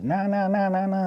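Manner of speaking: high-pitched imitation of yammering; mock yelling over phone. Mother yelling at the cop through the phone, mock rant. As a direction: imitate someone ranting down the line. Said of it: "I could hear her yelling at the cop through the phone".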